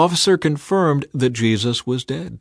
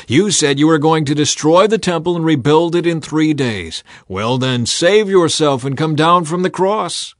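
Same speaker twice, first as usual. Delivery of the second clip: loud, higher jeering yell; yelling abuse; 6 semitones higher, 6 dB louder than usual. A narrator's reading. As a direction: hurl the insult at him. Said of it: "they yelled at him".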